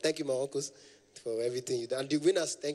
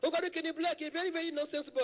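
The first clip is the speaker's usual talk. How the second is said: extremely high mock caller; imitated high-voiced man. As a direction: exclaim it all up at the top of the voice. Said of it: "their voice is very, very high".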